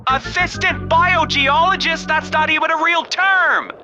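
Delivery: high, loud disbelieving outburst; outraged shout. Acted. Recruit shouts at the sergeant over his ridiculous job title.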